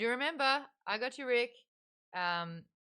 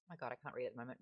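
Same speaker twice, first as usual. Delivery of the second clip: normal talking voice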